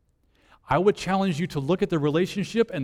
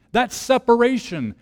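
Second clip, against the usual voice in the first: ordinary talk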